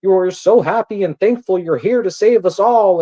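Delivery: high, loud gushing mock praise; mock fawning. Girls fawning over Bird, mocked with a high loud gush. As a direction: gush like a sycophantic fan. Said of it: "he'll have all these bitches over there like".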